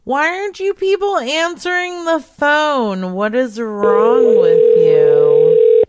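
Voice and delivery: loud, high exasperated shout, slowing at the end; frustrated yell. Real, not acted. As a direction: shout at the hold menu. Yelling at unanswered phone.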